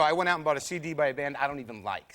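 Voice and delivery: ordinary talk, nothing marked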